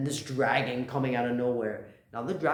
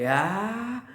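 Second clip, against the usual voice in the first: higher, louder drawn-out "aah"; mock battle cry; 9 semitones higher, 6 dB louder than usual. Retelling Ryuma leaping at the dragon, mock yell. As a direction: imitate the hero's charging yell.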